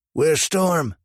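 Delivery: high, loud yell down the hall. A narrator's reading. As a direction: call him out, loud and demanding. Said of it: "a loud, growly voice yelled".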